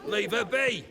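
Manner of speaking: sharp shout, high and fast; angry rebuke. Acted. Olgierd scolding a guest for mistreating a woman, angry shout. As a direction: bark them off her.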